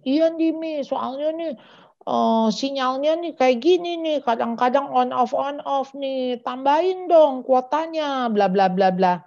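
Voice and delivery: put-on high-pitched whiny kid griping to mom; complaining child. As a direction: plead in a high voice, like a kid with excuses.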